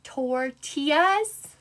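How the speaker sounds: Tortillas? very slow and soft, rising; musing over how to say Tortillas?; doubtful tortillas. Shown as a demonstration. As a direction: draw Tortillas? out quietly, unsure. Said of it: "I don't even know how I said it now".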